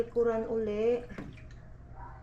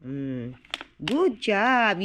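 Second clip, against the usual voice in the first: usual speaking voice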